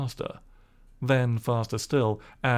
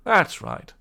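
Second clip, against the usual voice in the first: louder, lightly raised gentleman; gentleman's voice; 5 semitones higher, 5 dB louder than usual. Acted. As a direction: approve kindly.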